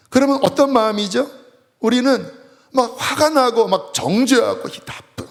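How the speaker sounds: normal talking voice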